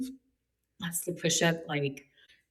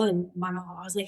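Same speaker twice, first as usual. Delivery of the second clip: normal talking voice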